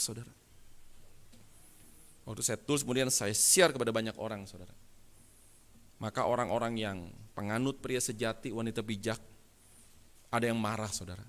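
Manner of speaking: usual speaking voice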